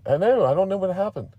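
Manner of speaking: high, flustered protest; flustered character. Acted. Character frets over his changed accent.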